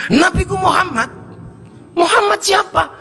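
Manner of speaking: high-pitched exchange; mock questioning voice. Villager and Prophet questioning.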